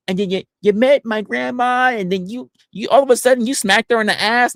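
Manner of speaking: high-pitched imitation of a reminiscing caller; mock eager accuser. Accuser reminding streamer of San Diego, high mock voice.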